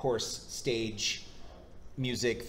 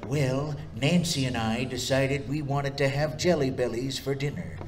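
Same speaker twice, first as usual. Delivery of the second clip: put-on presidential voice at his usual pitch; Reagan impression. Eight-year-old matching his uncle's impressions, mock Reagan. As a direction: impersonate an old-time president. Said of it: "eight years old going".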